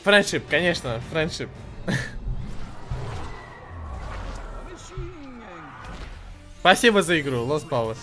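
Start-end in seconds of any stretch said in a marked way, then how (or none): 1.5-6.5 laughing. Real, not acted. Laughs after match.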